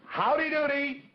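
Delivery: high, bright-pitched puppet voice calling howdy doody; puppet greeting. Shown as a demonstration. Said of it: "Well, let's try it".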